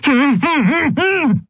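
high, wordless "mm-mm" noises; muffled protesting hums. A narrator's reading. Captive captain answers the pirates, muffled high humming. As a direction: hum urgently without opening the mouth. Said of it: "brachte Zaylar hervor und schüttelte den Kopf".

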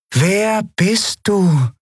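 high, very slow, otherworldly lilt; strange inhuman voice. Acted. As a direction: ask like a melodic alien creature. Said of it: "eine fremde und gleichzeitig seltsam vertraute Stimme".

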